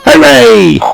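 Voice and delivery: high happy hooray; cheer. Acted. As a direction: cheer for the fixed pot. Everyone cheers.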